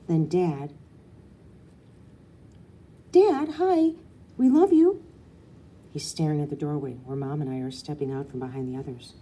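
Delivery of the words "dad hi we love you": put-on high, loud little girl calling out; eager child. A narrator's reading. Little girl calling to her dad, high and loud. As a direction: bounce it out like an excited kid.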